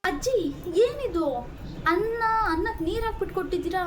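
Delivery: high, whiny complaint to grandma; whiny protest. Acted. New mother whines to grandma about watery rice, high and pouty. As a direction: wrinkle your nose and whine high.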